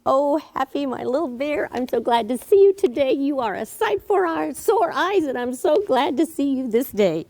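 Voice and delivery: teacher acting out a good touch with the bear puppet, gushing warmly; friendly greeting. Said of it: "so I might do something like this".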